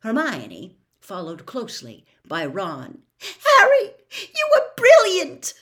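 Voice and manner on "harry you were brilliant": very high, loud, excited squeak; high-pitched emotional squeak; squeaky. A narrator's reading. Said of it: "Hermione said squeakily".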